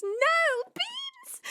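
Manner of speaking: hammed-up despair at the hype train; mock anguished squeal. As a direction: squeak out a playful protest. Streamer begs chat not to gift.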